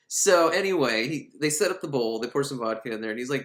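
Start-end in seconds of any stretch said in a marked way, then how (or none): none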